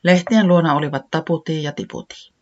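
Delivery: usual speaking voice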